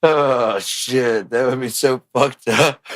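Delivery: usual speaking voice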